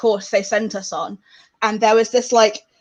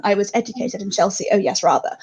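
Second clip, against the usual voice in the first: lower-pitched mock-posh Chelsea voice; posh Chelsea accent; 4 semitones lower than usual. Recalling a Chelsea schooling, mock posh accent. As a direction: put on a posh English accent, hammed up. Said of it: "quite a posh".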